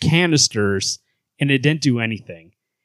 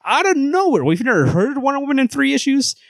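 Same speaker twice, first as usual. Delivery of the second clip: high-pitched disbelieving rant; incredulous; 12 semitones higher than usual. Real, not acted. Host complaining about Wonder Woman, high incredulous voice.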